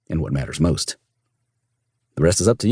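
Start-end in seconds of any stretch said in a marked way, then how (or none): none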